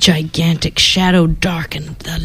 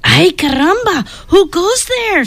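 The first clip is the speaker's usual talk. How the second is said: excited Chihuahua voice; 10 semitones higher than usual. Acted. Skippy's excited character voice.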